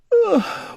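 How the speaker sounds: high sobbing intake of breath; a storybook sob-gasp; tearful gasp. A narrator's reading. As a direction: gasp as the tears come. Owl crying over sad thoughts.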